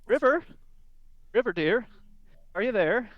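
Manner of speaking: high, loud call through a door. Acted. Glamdry calling River through the door. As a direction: call out for the halfling woman.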